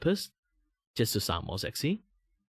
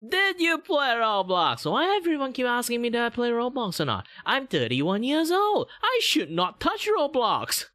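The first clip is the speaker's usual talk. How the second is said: much higher, exasperated anime-style streamer voice; high VTuber voice; 5 dB louder than usual. Acted. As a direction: VTuber scoffing at Roblox requests.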